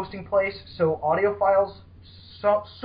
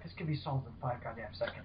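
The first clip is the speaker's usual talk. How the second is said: exasperated aside; 7 semitones lower, 15 dB quieter than usual. Retold or imitated. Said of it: "And you're just like".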